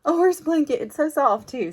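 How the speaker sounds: high, loud delighted squeal over a gift; excited gasp. Retold or imitated. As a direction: daughter opening a gift in the truck, delighted. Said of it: "she opened it in the truck and was like".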